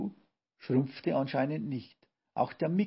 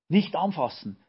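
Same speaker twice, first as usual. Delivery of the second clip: loud, higher cry of warning; raised voice, warning them off; urgent warning shout; 5 semitones higher, 7 dB louder than usual. A narrator's reading. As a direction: call out sharply to stop them. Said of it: "rief Brausewein".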